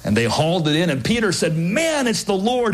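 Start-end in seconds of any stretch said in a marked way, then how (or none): 1.6-2.7 high, thrilled exclamation; excited recognition. Acted. Peter recognizing the Lord from the boat, thrilled. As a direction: blurt it out in wonder.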